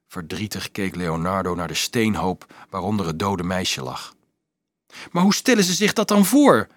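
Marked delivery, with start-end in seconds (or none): 5.1-6.7 high, loud astonished question. A narrator's reading. Dolf asks Leonardo how children could fight, astonished. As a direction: still gaping in disbelief. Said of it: "nog steeds met open mond".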